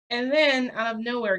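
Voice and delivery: dramatic build. Real, not acted. Dramatic reveal in story.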